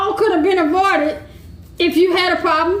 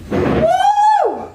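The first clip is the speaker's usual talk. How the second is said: woo, high and bright; high whoop. Real, not acted. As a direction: let out a high celebratory whoop. Whoops after finishing speech.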